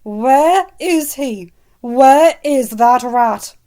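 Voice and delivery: very loud, slow, menacing; growling. Acted. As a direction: grind out each word in fury. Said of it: "practically growled".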